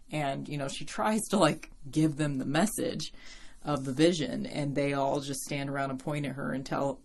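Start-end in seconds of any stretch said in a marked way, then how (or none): none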